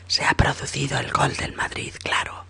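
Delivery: narración susurrada y suave; susurro de narrador. A narrator's reading. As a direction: contarlo en un susurro, casi sin voz. (narrador susurrando la escena)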